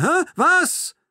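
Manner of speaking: very high, loud demand. A narrator's reading. Captor demands an answer from the narrator, high and loud. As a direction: fire off high, agitated questions. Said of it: "fragt er mit hoher Stimme".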